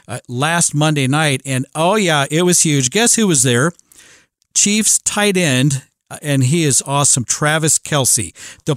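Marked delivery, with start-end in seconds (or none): none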